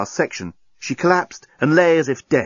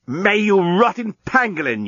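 yelling; 8 semitones higher than usual. A narrator's reading. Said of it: "yelled the journalist".

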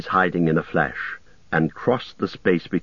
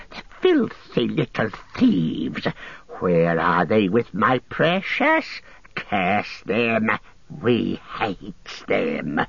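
whining Gollum creature voice; slower than usual. A narrator's reading. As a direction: moan like a creature robbed of its treasure. Gollum cursing.

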